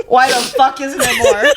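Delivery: usual speaking voice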